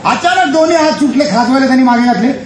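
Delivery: normal talking voice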